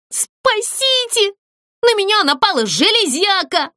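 very high cry for help; squealing. A narrator's reading. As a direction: squeal in a high, panicky character voice. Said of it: "завизжала Злюня". (визгливо, зовя на помощь)

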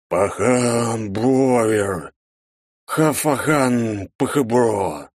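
very slow, warped, garbled voice; glitching voice. A narrator's reading. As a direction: stretch it out like a broken speaker. Spirit voice glitching out.

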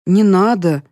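ordinary delivery

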